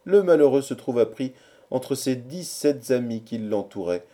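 usual speaking voice

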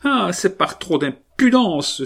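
wife cries out at her husband's impudence, exasperated; exasperated cry. A narrator's reading. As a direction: cry out, fed up with his nerve. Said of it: "s'écria la Créole exaspérée".

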